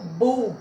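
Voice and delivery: BU said alone with emphasis; isolated, held bu; stressed syllable. Shown as a demonstration. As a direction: pick out bu as the strong syllable. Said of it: "é uma sílaba tônica".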